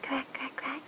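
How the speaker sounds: soft unvoiced quacking; whispered quacks.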